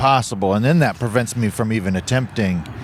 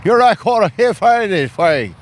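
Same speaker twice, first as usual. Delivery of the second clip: put-on drunk slur; 7 semitones higher, 4 dB louder than usual. Acting out the drunk guy.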